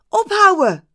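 high, loud shout of protest. Acted. Young woman in the audience shouts to stop the kiss. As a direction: yell it to stop them. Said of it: "riep ze".

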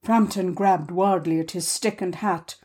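plain reading voice

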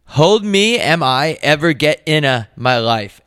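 put-on high, loud Jamaican accent, slowing; sing-say it with a Jamaican lilt. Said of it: "Jamaican people write in their accent".

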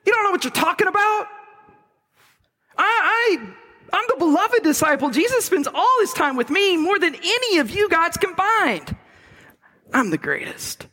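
high-pitched, fast, indignant protest; fuming John. Acted. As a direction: sputter in outrage at Peter. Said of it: "he's fuming".

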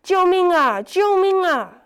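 high, loud panicked yelling; cry for help. A narrator's reading. Tied-up rabbit screams for someone to save him, panicked. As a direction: scream for help with all he has. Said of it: "于是大叫".

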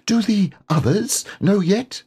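tiny eager squeak; high little piglet. Acted. Piglet asking.

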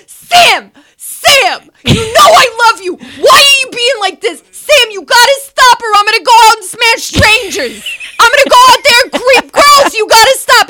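high, hammed-up wailing plea; desperate pleading. Acting out Ron begging Sam to stop, desperate pleading. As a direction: beg up high, imitating the frantic boyfriend. Boyfriend acted pleading in a fight.

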